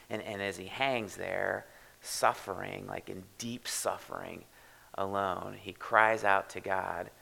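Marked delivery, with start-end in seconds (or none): none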